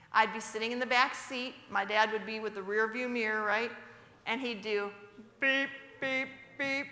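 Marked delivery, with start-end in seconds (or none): 5.4-6.9 imitated car horn beeps. Father teasing mother from the car, mimicked horn.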